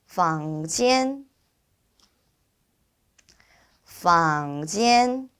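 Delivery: careful, evenly spaced Mandarin 房间; repeat-after-me model. Shown as a demonstration. Teacher leading students to repeat 房间, slow careful model. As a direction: draw out 房间, rising then level. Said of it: "발음 한번 우리 따라서".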